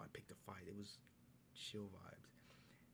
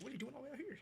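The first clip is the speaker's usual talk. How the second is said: much higher and louder, astonished; amazed mock question; 4 dB louder, much faster than usual. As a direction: play the stunned bouncer. Staff amazed at his ID.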